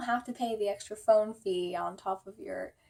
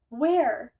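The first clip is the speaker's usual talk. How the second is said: sharp question; 2 semitones higher, 8 dB louder than usual. Retold or imitated. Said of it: "I was like".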